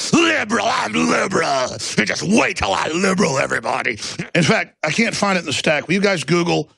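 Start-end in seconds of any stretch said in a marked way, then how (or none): 0.1-3.9 mock liberal. Mocking elites at parties.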